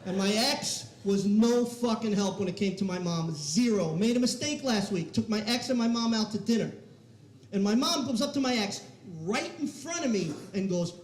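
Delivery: ordinary delivery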